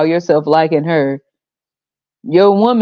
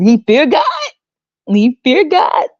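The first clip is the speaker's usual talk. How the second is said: slow, high mock voice; mocking pious woman; 8 semitones higher than usual. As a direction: draw out fear God like a pious girl. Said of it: "still waiting for them to say something smart".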